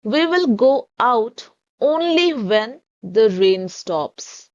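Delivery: every word stretched very slowly; very slow dictation. Shown as a demonstration. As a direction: read it slowly for the class. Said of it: "इसे हम English में कहेंगे".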